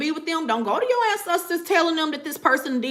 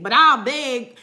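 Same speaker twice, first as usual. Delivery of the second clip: ordinary talk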